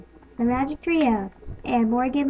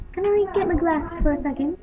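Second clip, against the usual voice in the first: ordinary delivery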